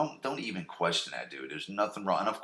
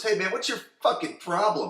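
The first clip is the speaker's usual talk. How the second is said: mock tough challenge; 9 semitones higher than usual. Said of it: "goes up to him and goes".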